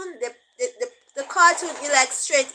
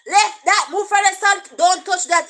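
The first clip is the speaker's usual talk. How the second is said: firm patois command; 5 semitones higher, 7 dB louder than usual. Retold or imitated. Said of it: "Him understand".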